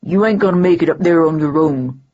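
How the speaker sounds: a lightly gruff working-class cabbie; blunt but helpful driver. A narrator's reading. Troll cabbie offering his arm.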